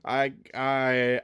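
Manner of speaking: ordinary talk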